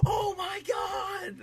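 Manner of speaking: very high, drawn-out imitated scream; crowd freak-out. Crowd jumping to their feet at a kickout, high drawn-out cry. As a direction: imitate fans leaping up in disbelief, stretching every word. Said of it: "jump to their feet and go".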